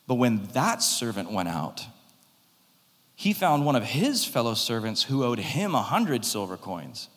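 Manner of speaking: ordinary delivery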